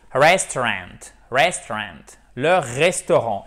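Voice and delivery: restaurant with a strong English r and a full ending; rolled r demo. Shown as a demonstration. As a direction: lean into the r in restaurant. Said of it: "faut pas hésiter à faire le r".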